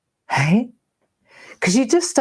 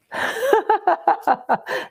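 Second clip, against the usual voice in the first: high fond laugh; 11 semitones higher than usual. Real, not acted. Fond photos of her children.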